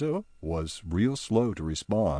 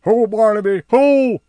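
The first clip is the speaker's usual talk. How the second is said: high, loud demanding cry; High demanding an answer, high and loud; High urgent; 12 semitones higher, 11 dB louder than usual. Acted. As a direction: jump up and demand. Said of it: "said High".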